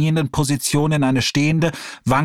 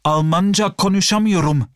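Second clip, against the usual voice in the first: feigning foreigner; 7 semitones higher than usual. Acted. Hassan feigns speaking no German.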